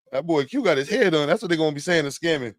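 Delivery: usual speaking voice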